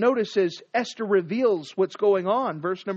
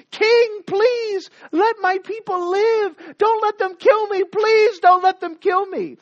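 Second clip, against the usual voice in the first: much higher, louder, slow begging cry; desperate pleading queen; 11 semitones higher, 7 dB louder, slower than usual. Acted. Esther crying out to the king for her people, desperate. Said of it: "I've got to say something".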